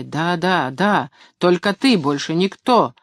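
ordinary delivery

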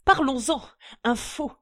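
loud, mocking accusation; unleashed brute sneering at the count. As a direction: jeer at the forger. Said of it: "la brute déchaînée ricana".

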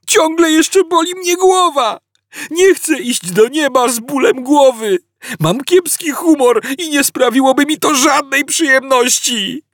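Arthur whining, pitched high; high whining complaint. A narrator's reading. Arthur complaining to the guard, high whine. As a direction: moan your misery. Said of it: "zawył Artur".

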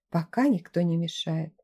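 plain reading voice

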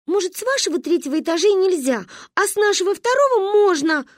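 put-on high-pitched child digging in; stubborn insistence. A narrator's reading. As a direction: argue back like a stubborn kid. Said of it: "упёрлась Манька". (упрямо, высоким голосом)

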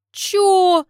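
stunned what?; baffled. Acted. Baffled reply.

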